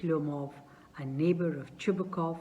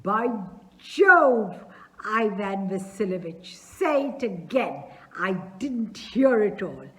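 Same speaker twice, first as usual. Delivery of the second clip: father hearing the proposal he hoped for, overjoyed and loud; joyful exclamation; 2 semitones higher, 8 dB louder than usual. Acted. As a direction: explode with joy.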